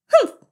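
very high, loud huff; indignant humph. Acted. Mouse rejecting bunny's apology, high indignant humph. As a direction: squeak out a short huff.

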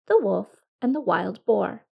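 ordinary delivery

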